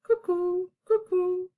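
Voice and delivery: high two-note cuckoo; cuckoo call. A narrator's reading. Reader recapping the cuckoo's noise, calling. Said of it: "The cuckoo called".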